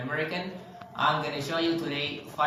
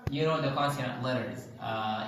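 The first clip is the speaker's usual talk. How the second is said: ordinary talk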